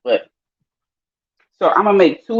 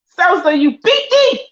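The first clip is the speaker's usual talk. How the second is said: pitched way up, crying out in defeat; high excited yelp; 12 semitones higher, 4 dB louder than usual. Real, not acted. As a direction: yelp it high like you just lost. Losing a game, exclaiming.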